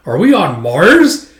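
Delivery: slow, loud, rising question; retold amazed question; acted incredulity. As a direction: stretch it out in disbelief. Retold disbelief at location.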